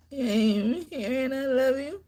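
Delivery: usual speaking voice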